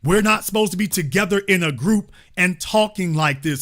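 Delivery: usual speaking voice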